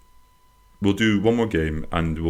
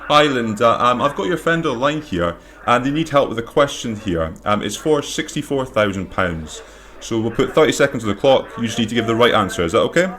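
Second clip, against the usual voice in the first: bright, raised TV host patter; game show host voice; 6 semitones higher than usual. Pretend. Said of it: "I need to put on my game show host voice".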